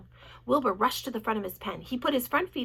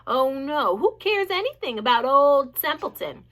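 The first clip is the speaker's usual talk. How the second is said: sarcastic rat; 2 semitones higher, 8 dB louder than usual. A narrator's reading. Rat sarcastic self-pity.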